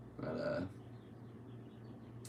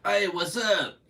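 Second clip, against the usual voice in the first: much higher, louder imitated Spanglish radio accent; 13 semitones higher, 9 dB louder, slower than usual. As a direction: do the cheesy radio Spanglish host. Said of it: "this is the voice they had on the radio".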